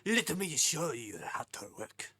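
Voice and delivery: exaggerated mock-French; attempted French accent. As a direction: show them round in bad French accent. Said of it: "Can't do a French accent".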